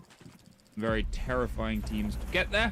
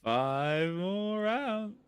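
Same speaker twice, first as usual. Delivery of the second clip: drawn-out groan; 7 semitones higher, much slower than usual. Real, not acted. Groaning at five more rounds.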